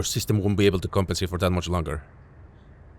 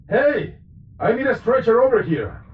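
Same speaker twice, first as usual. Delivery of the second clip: shouting for help; 5 dB louder than usual. Acted. Medic calls for a stretcher.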